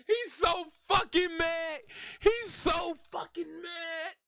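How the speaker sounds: slow, laughing through the words; cracking up at him. Real, not acted. Laughing at angry driver.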